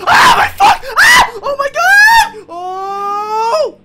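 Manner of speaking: very high, loud shrieks of fright; terrified screaming. Real, not acted. Player screaming at a skull in the game, terrified screaming. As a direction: scream in real terror, drawing out the cries. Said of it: "laughing 'cause I screamed".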